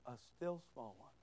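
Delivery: hushed, very low and soft; very small voice. Shown as a demonstration. Preacher making congregation look closer, very small hushed voice. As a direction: drop almost to nothing. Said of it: "Not a big, fat, loud one".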